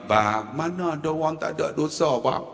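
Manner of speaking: low, softened confiding voice; whispering in the ear. Retold or imitated. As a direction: imitate a soft word into someone's ear. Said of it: "saya bisik kat telinga". (bisikan lembut di telinga)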